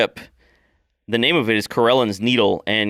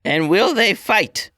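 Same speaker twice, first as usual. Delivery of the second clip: high, slow probing question; old orc elder; 9 semitones higher, slower than usual. Acted. Elder questions Mama Sass, high and slow. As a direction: weigh the answer carefully. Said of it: "the old woman".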